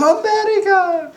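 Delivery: very high mock-thrilled squeal; excited Polish lady. Middle-aged Polish neighbor hearing America, hammed-up excitement. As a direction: put on a high, gushing lady's voice. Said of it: "and she was excited".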